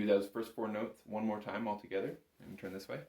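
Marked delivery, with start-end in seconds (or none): none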